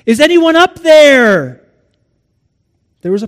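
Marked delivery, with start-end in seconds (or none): 0.0-1.7 loud, high, stretched-out call; desperate holler from a cliffside; crying out for help. Acted. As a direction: shout up to whoever might hear. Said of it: "begins to cry out for help".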